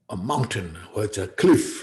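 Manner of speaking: normal talking voice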